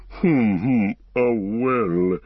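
put-on long hums from an old tree-creature; slow, resonant musing; Treebeard, pondering. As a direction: hum heavily while searching for a reply.

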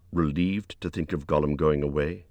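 usual speaking voice

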